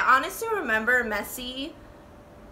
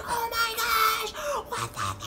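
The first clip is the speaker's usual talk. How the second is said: panicked yelling. Retold or imitated. Passengers panicking at the drunk driver. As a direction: freak out as the car goes up. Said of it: "we were like".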